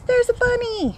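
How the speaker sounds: high, excited squeal of discovery; delighted exclamation. Real, not acted. Gardener spotting a bunny mid-tour, delighted exclamation. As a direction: pitch leaps up with sudden delight.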